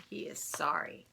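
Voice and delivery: hushed threat, breathy and low-key; menacing whisper. Acted. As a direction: whisper it like a warning to a little brother. Threat about the dividing line.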